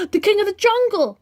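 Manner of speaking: high, loud shout; alarmed cry. Acted. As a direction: call out the danger in alarm. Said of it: "cried the cave aliens".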